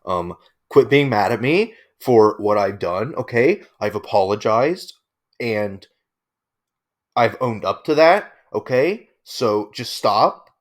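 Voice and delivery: passive aggressive mock. Mimicking the apology video to show its attitude, mock passive aggression. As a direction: parody his fake-sorry tone. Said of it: "very passive aggressive".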